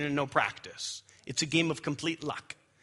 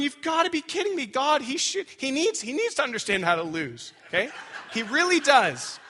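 mock exasperation; 9 semitones higher than usual. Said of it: "Two turns of going".

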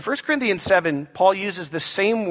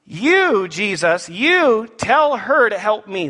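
loud, slow, insistent order; bossy demand; 3 semitones higher, 4 dB louder, much slower than usual. Acted. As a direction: order them about, leaning hard on YOU. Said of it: "she then proceeds to tell Jesus".

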